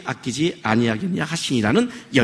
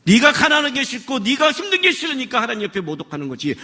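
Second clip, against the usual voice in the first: ordinary delivery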